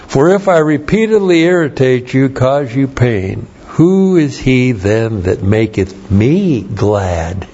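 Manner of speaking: put-on reading the old verse slowly; slow King James reading. Shown as a demonstration. Pastor reading the King James verse slowly to close. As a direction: savor the archaic wording. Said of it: "Maybe I'll have to use that".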